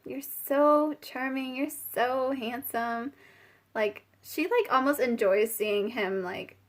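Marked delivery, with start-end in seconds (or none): 0.0-3.1 slow, drawn-out mock swooning; mesmerized. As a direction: stretch it out as if entranced by him. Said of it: "like, mesmerized".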